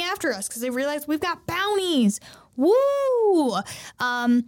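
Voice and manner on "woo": loud breathy whoop; cheer. Retold or imitated. Cheering as the brothers fight the bad guys. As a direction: let out a hype whoop.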